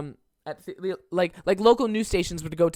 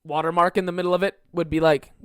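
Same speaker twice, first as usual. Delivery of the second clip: ordinary talk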